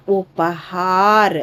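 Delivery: ఉపహార్ said very slowly; slow model word. Shown as a demonstration. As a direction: stretch ఉపహార్ for repetition. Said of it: "పలకండమ్మా".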